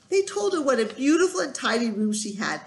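ordinary delivery, nothing marked

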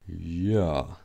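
low, drawn-out reply; ominous assent. A narrator's reading. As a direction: agree darkly, with a plan in mind. Big engine answers, plotting revenge.

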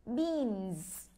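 Beans said slowly with a clear final z buzz; model pronunciation. Shown as a demonstration. Teacher modelling Beans for class to repeat, clear and slow. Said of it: "Cô mời mọi người đọc theo cô nha".

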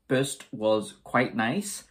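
usual speaking voice

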